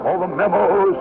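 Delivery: put-on high, jolly radio Santa ad-libbing to the kids; lively hammed-up Santa. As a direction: play Santa confiding to boys and girls. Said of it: "I began to ad lib little things".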